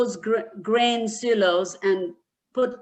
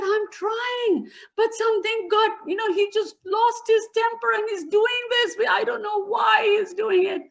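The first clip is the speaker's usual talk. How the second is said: high, shouting, close to tears; desperate, crying out defense; panicked and tearful; 10 semitones higher than usual. Acted. Grandson answering his angry father, high and tearful.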